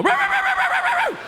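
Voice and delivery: high yapping puppy roos. Retold or imitated. Comic imitating a puppy's high yips at a sleeping cat. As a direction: yip it like a tiny sneaking puppy. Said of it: "that little puppy would sneak up behind it and go".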